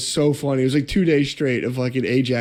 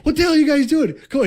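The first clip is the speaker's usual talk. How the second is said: yelled much higher and louder, exasperated boss; hammed-up boss yell, pitched way up; mock yell; 13 semitones higher, 4 dB louder, faster than usual. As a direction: bark it like a fed-up foreman catching slackers. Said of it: "He would, like, yell at us".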